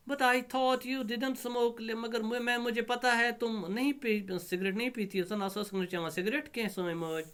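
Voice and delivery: high-pitched daughter's protest; put-on high voice, taken aback; astounded daughter. Astounded daughter answers her mother.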